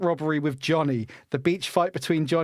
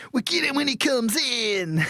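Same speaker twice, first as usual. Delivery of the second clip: higher-pitched mock Australian cop; Australian copper accent; 9 semitones higher than usual. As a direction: put on a hammed-up Aussie policeman. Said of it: "Australian copper".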